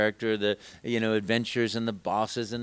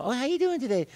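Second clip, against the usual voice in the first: much higher cheerful Italian accent; Mario; faster than usual. Shown as a demonstration. Mario greets kids through the TV, high and cheerful. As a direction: greet them bright like Mario. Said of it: "Mario's voice".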